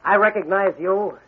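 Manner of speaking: usual speaking voice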